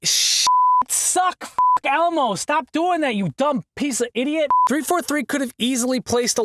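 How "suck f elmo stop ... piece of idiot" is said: scolding himself. Real, not acted. Scolding himself after flub.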